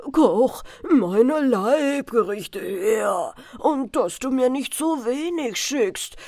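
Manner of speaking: loud, slow demanding call. Acted. Greedy prince calling into the kitchen, loud and slow. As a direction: bellow your orders at the kitchen.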